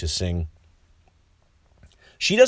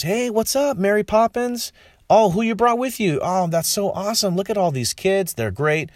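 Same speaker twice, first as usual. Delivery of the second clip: high, friendly cartoon wolf greeting; chirpy fake-nice welcome; 9 semitones higher than usual. Wolf greeting the kids with a high friendly voice. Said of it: "and he goes".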